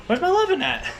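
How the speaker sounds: high whiny pet voice; dog voice. Retold or imitated. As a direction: squeak it out like a needy dog. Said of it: "So now she's like".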